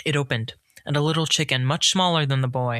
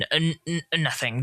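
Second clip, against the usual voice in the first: halting stammer; 3 semitones higher than usual. Acted. Stangler stammering to the spirit, flustered. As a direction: trip over the word, embarrassed. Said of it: "Stangler muttered".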